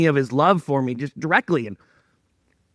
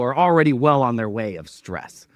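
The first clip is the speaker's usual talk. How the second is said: ordinary delivery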